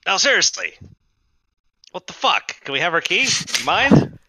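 high, loud fed-up demand; exasperated squatter. Acted. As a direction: push hard for the key. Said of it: "He goes".

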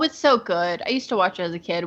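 normal talking voice

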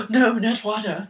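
high, loud mock horror; hammed-up fear of water.

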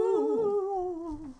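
high, drawn-out ghost moan; ghostly ooh. Acted. Ghostly ooh after intro.

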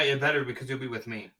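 ordinary talk, nothing marked

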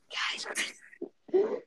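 slow drawn ASMR whisper. Pretend. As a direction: stretch it out in a whisper. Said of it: "Are we doing the A S M R?".

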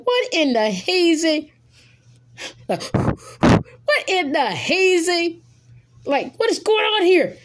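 high-pitched, drawn-out retold disbelief; disappointed disbelief. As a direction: stretch it out in mock bewilderment. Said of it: "I was disappointed when I heard that".

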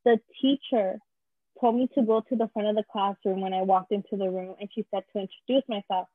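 ordinary delivery

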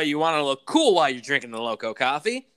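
usual speaking voice

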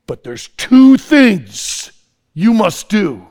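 loud, very slow, stretched-out pronouncement; commanding chief. Acted. Gurkha chief giving conditions to the commander, loud and commanding. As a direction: lay down terms like a solemn chief.

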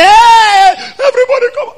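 high, loud wailing call for help; shrieking fake-crying cry for help; mock crying alarm. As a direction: wail for the household with fake tears. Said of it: "she now cried and said".

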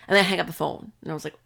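ordinary talk

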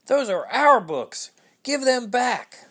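loud, high indignant yell, slowing on the demand; children shouting to get their books back. Acted. Children confronting the book thief, loud high yell.

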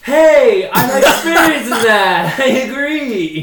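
high, loud, slow laughing; laughing agreement. Real, not acted. Friend laughs at bad online dating news. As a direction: burst out Hey and laugh through it.

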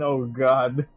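normal talking voice

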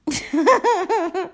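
short loud laugh; burst of laughter. Real, not acted. Laughing at the girl's claim, a burst of laughter.

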